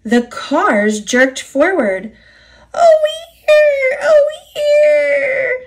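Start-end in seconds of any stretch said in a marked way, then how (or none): 2.7-5.6 screamed. A narrator's reading. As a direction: scream like freight cars dragged along. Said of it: "they screamed".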